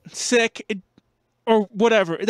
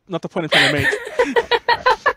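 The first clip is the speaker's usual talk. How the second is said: laughing and slowing down; can't stop laughing; 12 semitones higher, slower than usual. Real, not acted. Laughter breaks through.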